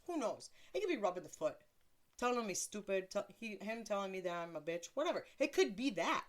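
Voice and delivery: ordinary delivery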